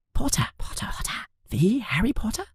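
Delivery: hushed whisper; children whispering in the hall. Acted. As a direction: breathe it in awe, voiceless. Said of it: "the children say quietly".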